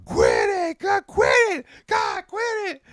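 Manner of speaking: very high, strained yell; mock exasperated shout. Imitating a man telling the comic to stop, high mock shout. Retold yelling at misbehaving comic.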